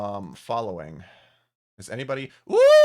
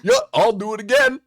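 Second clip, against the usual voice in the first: higher, louder cartoon dope; Goofy voice; 8 semitones higher, 6 dB louder than usual. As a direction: chirp it like a dopey cartoon dog. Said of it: "I love doing Goofy's voice".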